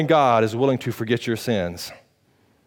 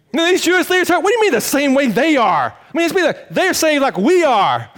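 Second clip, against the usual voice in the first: high, loud fervent preaching; preaching at full force; 12 semitones higher, 6 dB louder than usual. Acted. Pastor telling the church how Peter flipped it, loud and high. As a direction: hammer the point with fire.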